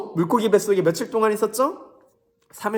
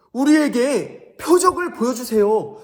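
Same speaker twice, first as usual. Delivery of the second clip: higher, louder, slow begging; pleading Pharisee; 7 semitones higher, 6 dB louder than usual. Acted. Pharisees demanding a sign from Jesus, slow pleading. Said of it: "바리새인들과 서기관들이 예수님께 말했어요".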